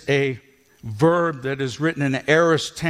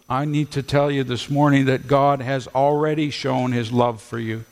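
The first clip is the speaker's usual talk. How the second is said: ordinary talk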